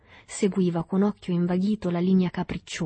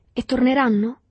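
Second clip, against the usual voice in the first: anxious; 6 semitones higher, 6 dB louder than usual. A narrator's reading. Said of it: "domandò Agostino ansioso".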